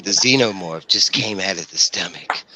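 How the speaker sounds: smooth flashy pimp swagger. Retold or imitated. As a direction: narrate it like a slick pimp. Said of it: "he'd be doing all in this pimp voice".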